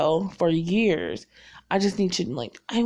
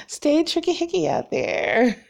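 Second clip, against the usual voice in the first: higher, slow playful send-off; playful sign-off; 9 semitones higher than usual. Real, not acted. Signing off to viewers with a cheery, higher call. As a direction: toss out the catchphrase with a grin.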